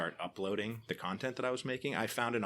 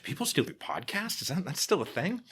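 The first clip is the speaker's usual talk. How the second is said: higher, mock astonished question; incredulous surprise; 12 semitones higher, 4 dB louder than usual. Podcaster imitating future people, mock incredulous. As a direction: act amazed that anyone still podcasts. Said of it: "and people say".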